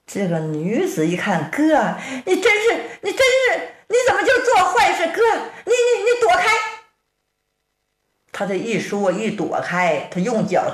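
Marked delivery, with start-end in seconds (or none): 1.5-6.5 put-on high-pitched young woman pleading with her brother; a sister half-laughing, half-scolding, pitched high; girl's high scolding voice. Sister begging her brother to stop, high and pleading.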